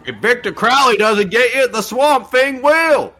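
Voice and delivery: high, loud, drawn-out warning; high spooky warning. Pretend. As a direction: ham up the horror warning. Spooky movie tagline voice.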